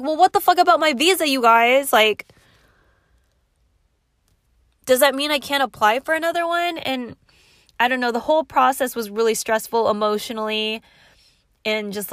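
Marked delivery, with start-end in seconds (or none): 0.0-6.8 louder, higher indignant demand; frustrated demand to the agency about the visa; imitating an exasperated outburst; retold frustrated demand.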